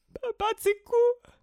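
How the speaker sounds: high, loud, drawn-out wail of grief; sobbing. A narrator's reading. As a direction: sob it out slowly, breaking down. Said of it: "sobbed Taiwo, breaking down again".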